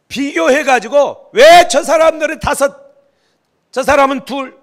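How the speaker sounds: loud, slow, high-pitched grumbling; resentful servant's complaint. Retold or imitated. Servant complaining to his master, in a loud, slow grumble. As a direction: voice the jealous servant crying out unfairly.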